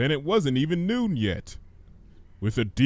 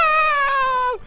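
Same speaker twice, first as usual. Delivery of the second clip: high, loud terrified shriek; 6 dB louder than usual. Acted. Fluttershy fleeing Gummy's prophecy, terrified high shriek. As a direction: scream it out in terror. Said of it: "Fluttershy shrieked".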